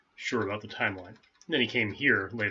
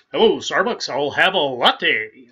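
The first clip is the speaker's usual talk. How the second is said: much louder, higher, slow booming radio announcer order; Paul Harvey-like voice; 6 semitones higher, 14 dB louder, slower than usual. Retold or imitated. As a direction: drawl it out like a big old radio newsman. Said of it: "that was Paul Harvey".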